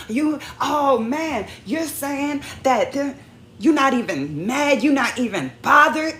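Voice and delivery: exasperated outburst. Retold or imitated. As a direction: let your frustration at the spouse boil over. Re-enacting a fight with her spouse.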